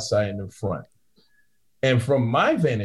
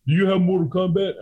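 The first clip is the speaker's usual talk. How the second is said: higher, goofy patron voice asking; mock drunk patron; 8 semitones higher than usual. Bouncer imitating the patron asking for a game, high and goofy. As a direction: play the dopey customer.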